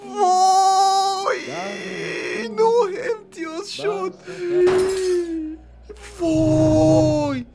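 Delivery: long, very high drawn-out wail; dismayed wail. Real, not acted. Player reacting to the points result, high dismayed wail. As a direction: stretch the cry out in a high squeal.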